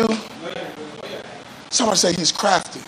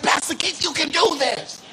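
very high, fervent quoted cheer-on; imitated encouragement; 10 semitones higher than usual. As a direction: act out someone urging the pastor on, pitched high. Voices someone encouraging the pastor.

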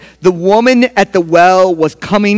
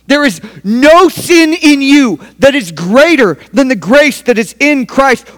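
higher and louder declaration; preacher proclaiming grace, higher and louder; repeated proclamation; 7 semitones higher, 5 dB louder than usual. Shown as a demonstration. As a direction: proclaim it to the room.

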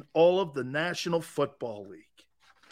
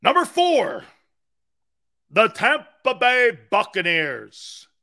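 hype announcer; 2 semitones higher, 5 dB louder than usual. Acted. Mock countdown announcer, ranking O-lines.

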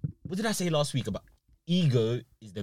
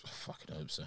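much lower, softer, breathy; whispered hope; 9 semitones lower, 19 dB quieter than usual. Real, not acted. Hoping aloud to his friend, whispered. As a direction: hiss it under breath. Hoping the story stays hidden.

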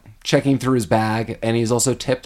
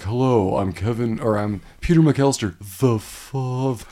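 put-on slowed Talk Boy voice. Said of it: "does call and slows down".

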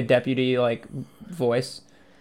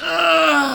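pained groan; 3 dB louder than usual. Acted. Acting sick reaching for milk.

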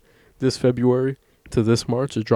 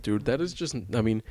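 ordinary talk

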